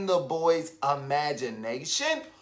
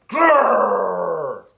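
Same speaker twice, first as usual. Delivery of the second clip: a loud, rough growl; the sister roaring like a tiger; 6 dB louder than usual. A narrator's reading. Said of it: "Just like the sound of a roaring tiger".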